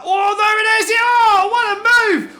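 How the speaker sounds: screaming with excitement; ecstatic shout. Real, not acted. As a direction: yell it like a huge overtake. Overtake completed.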